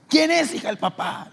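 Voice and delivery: mother yelling a third time; shouted demand. Retold or imitated. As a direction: yell at the girl for an answer.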